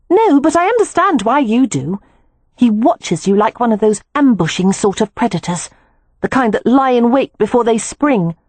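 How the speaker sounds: a hint of a younger sister, serious and watchful; lightly voiced Beatrix, earnest.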